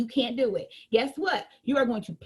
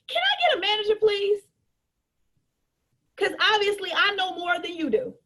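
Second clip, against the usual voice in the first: entitled customer; 5 semitones higher than usual. Retold or imitated. Pushy customer demands a manager.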